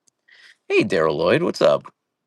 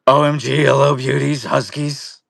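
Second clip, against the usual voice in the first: loud, higher all-caps shout-read; 5 semitones higher, 4 dB louder than usual. Shown as a demonstration. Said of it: "I'm gonna read it like that".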